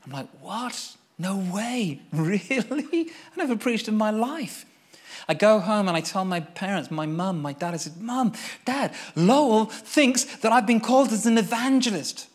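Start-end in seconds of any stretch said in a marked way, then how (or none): 0.4-4.7 higher, laughing disbelief; disbelieving. Retold or imitated. Told he's an evangelist. 8.0-12.3 higher, louder eager announcement; excited news. Retold or imitated.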